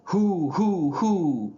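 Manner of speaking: high, swooping owl hoots; dramatic flair. A narrator's reading. Owl lecturer building up to his question, theatrical hooting. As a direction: hoot it theatrically for an audience. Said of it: "he repeated with dramatic flair".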